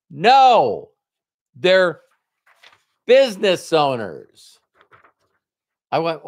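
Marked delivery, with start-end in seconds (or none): 0.1-4.6 emphatic retort. Retold or imitated. Sponsor's emphatic answer, voiced.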